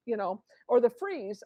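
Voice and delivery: usual speaking voice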